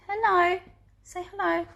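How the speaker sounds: high cooing hello to a pet; doting dog talk. Real, not acted. Presenter getting her dog to say hello, doting baby talk. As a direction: coax a pet sweetly, pitched high.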